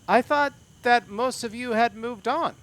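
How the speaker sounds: guard voice. Acted. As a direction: speak as the suspicious guard. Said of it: "He's like".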